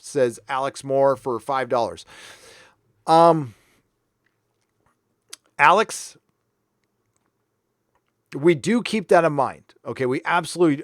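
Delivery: normal talking voice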